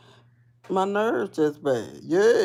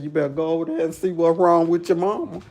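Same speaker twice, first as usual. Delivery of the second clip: hurried warning; faster than usual. Retold or imitated.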